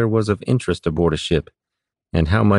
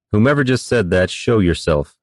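ordinary delivery, nothing marked

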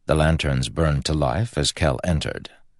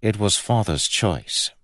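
grimly understated, a little higher; 5 semitones higher than usual. A narrator's reading. Prince to his brother about the assigned guard, grimly. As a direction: say it grimly, in character. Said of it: "and then added grimly".

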